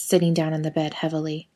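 ordinary delivery, nothing marked

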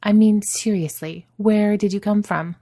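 intent Adam questioning. A narrator's reading. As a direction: press him hard for an answer.

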